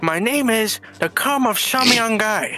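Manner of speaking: Vietnamese accent. Shown as a demonstration. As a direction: give the name in a mock Vietnamese accent. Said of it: "hear that in your actual tongue".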